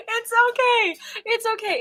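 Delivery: high, still-giggling reassurance; high laughing voice, calming down. Real, not acted. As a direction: talk through the tail of a laughing fit.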